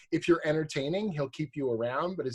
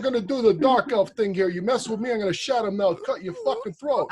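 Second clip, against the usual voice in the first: hammed-up mobster threat, pitched high; mobster Bobby Salvatore; 7 semitones higher, 7 dB louder than usual. Author mocks a colleague as a threatening mobster. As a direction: play a comic wiseguy making threats. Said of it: "I do this mobster voice".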